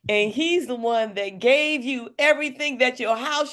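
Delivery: usual speaking voice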